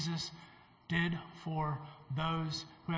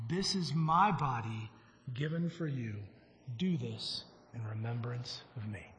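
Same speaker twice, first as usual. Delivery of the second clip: scripture reading; 2 semitones lower, 4 dB quieter than usual. Acted. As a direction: read the Lord's words with reverence, fading softly. Reads the communion scripture.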